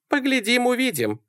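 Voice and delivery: high-pitched airy brush-off; voiced woman. A narrator's reading. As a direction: answer lightly in a high woman's voice. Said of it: "сказала Салли". (высоким женским голосом, отмахиваясь)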